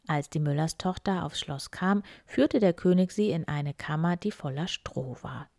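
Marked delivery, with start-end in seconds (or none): none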